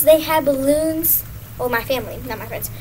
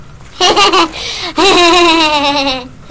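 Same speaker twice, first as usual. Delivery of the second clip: loud delighted laughter at own squeaky voice; helpless giggling fit; real laugh; 2 semitones higher, 4 dB louder than usual. Real, not acted. Laughing after hearing helium voice.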